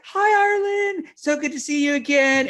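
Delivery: very high, sugary imitation of a mother; squealy put-on hello; gushing mom. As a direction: gush a mock-delighted greeting. Imitating gushing mom greeting daughter.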